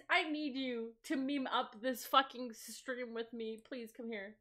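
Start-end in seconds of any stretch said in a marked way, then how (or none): none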